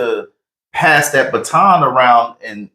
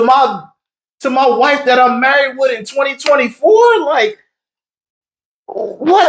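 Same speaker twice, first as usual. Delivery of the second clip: much higher, incredulous shouting of nineteen eighty-five; outraged disbelief; 14 semitones higher than usual. Retold or imitated. Husband explains past exes in his DMs, outraged and incredulous. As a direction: blow up in mock outrage at the dredged-up past.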